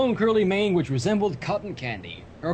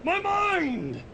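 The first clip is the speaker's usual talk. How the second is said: hammed-up cry; 12 semitones higher than usual. Hammed-up mind blown cry.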